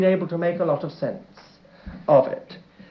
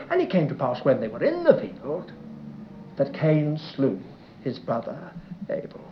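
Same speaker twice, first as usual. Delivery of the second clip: hushed solemn reading; 6 semitones lower, 7 dB quieter than usual. Shown as a demonstration. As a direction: let the words sink quietly, fading to Abel. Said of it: "it's much better, it's obvious".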